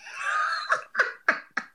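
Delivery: a high, helpless burst of laughter. Real, not acted. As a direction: break into high squealing laughter. Laughing at [name] album.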